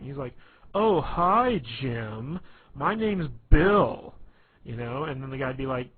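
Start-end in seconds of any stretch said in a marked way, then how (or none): 0.7-4.1 loud, high, drawn-out mocking sneer; sarcastic. Sarcasm Man greeting a stranger, loud sneering sarcasm. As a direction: make a friendly hello drip with sarcasm. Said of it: "it always sounded like ... making fun of them".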